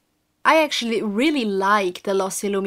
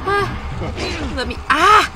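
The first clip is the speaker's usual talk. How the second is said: high, loud panicked yelp; real panic, voice jumping up; panicked cry; 7 semitones higher, 5 dB louder, much slower than usual. Real, not acted. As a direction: cry out trapped and scared. Caught in the cage.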